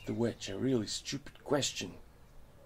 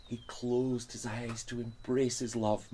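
plain reading voice

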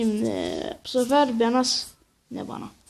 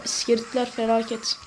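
usual speaking voice

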